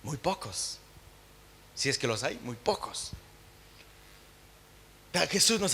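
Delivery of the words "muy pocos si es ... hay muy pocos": hushed lament. Acted. Sad answer to own question.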